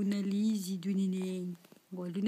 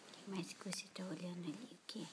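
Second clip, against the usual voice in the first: whispering; 3 semitones lower, 13 dB quieter, faster than usual. Real, not acted. Whispering to baby at night.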